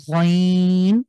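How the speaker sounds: very slow গইন from the back of the throat; throaty ghain. Shown as a demonstration. Teacher slowly demonstrates গইন from the throat. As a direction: drag out গইন with a rasping G. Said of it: "কণ্ঠের শেষ ভাগ থেকে".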